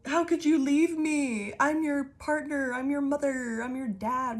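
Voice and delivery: high, slow, whiny fake sob; hammed-up tearful plea from a manipulative relative; mock guilt-trip crying. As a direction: wail it like a clingy parent begging you to stay. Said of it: "trying to keep you like".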